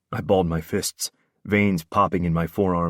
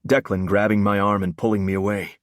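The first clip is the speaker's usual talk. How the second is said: ordinary delivery, nothing marked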